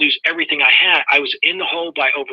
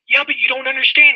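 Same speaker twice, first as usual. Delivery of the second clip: protesting; 10 semitones higher than usual. Retold or imitated. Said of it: "I'm like".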